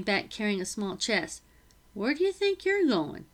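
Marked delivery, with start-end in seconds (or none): 1.9-3.3 high sharp challenge from the cook; stern housekeeper. Acted. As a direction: stop her in her tracks. Becky stops Isabel leaving.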